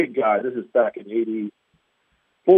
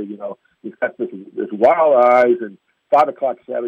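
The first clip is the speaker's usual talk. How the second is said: usual speaking voice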